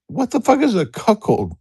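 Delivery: high-pitched incredulous question; baffled disbelief. Retold or imitated. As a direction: act out utter bafflement, voice shooting up. Retelling his baffled reaction.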